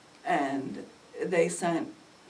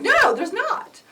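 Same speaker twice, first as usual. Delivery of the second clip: much higher, louder protest; high denial; 14 semitones higher, 9 dB louder, slower than usual. Retold or imitated. Mother to officer, rattled and insistent. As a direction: blurt it out, rattled.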